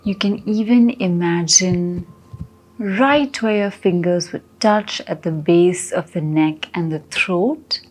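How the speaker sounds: slow, soft meditation voice; guided meditation. Acted. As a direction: ease them inward, slowly and gently.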